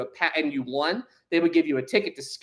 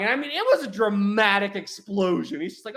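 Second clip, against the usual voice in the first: usual speaking voice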